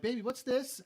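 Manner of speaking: put-on high, sweet coo to a toddler; dad cooing to distract his crying baby daughter. Shown as a demonstration. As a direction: pull her attention to the toy. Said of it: "grab one of her toys and be like".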